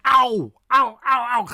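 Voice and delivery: loud, high ow sounds; exaggerated vowel. Shown as a demonstration. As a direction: punch each Ow. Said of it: "I'm exaggerating".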